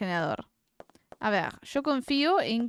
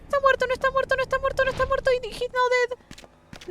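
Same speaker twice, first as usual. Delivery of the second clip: much higher, frantic repeating; 3 dB louder than usual. Real, not acted. Streamer panicking whether the dinosaur is dead, frantic and high.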